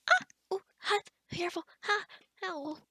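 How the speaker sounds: short, high yelps of pain; quick startled ows; reacting to a burn. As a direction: gasp and yelp as if touching something hot. Burns self on hot stew.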